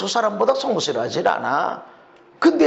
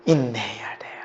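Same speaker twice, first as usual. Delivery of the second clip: whisper; 13 semitones lower, 6 dB quieter than usual. Real, not acted. Pleading for patience.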